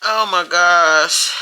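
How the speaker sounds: real tears breaking through, voice high and strained; crying. Real, not acted. Mother recalling her daughter's birth, crying openly. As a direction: she tries to speak but sobs take over. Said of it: "didn't wanna cry".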